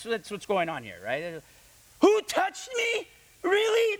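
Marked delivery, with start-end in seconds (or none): 2.0-4.0 very high, loud, slow mocking voice; sarcastic mockery. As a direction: ham up the disciples' disbelief. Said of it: "That's what they're saying".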